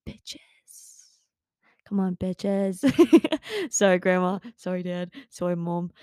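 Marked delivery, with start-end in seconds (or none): 2.9-3.7 high, loud burst of giggling; giggle. Real, not acted. Host cracking up at her own swearing, giggling. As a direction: burst into a quick high laugh. Laughs at saying the rude word.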